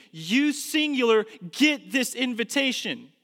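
preacher pressing the invitation on the congregation, intense; preaching at full force. Acted.

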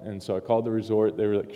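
normal talking voice

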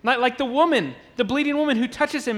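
ordinary talk

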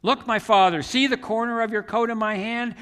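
loud, high plea shouted across a valley; shouting. Retold or imitated. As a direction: call out your defence to a distant king. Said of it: "he started shouting to".

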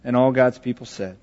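soft, low prayer voice; closing prayer. Acted. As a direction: gently close the prayer for the congregation.

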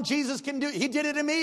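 usual speaking voice

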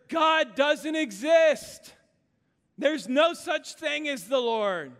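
annoyed neighbor shouting across the porch; yelling atheist. Acted. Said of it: "he started yelling at her".

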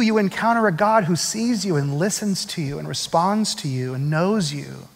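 normal talking voice

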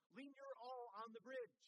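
loud imitated call across a yard; retold shout. Woman urging the pastor onto the bridge, imitated shout. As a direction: call it out like the woman on her porch. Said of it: "She shouted from her back porch".

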